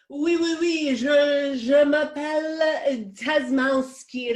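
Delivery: high, slow hammed-up French accent. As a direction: ham up a bad French accent. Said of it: "Be more continental".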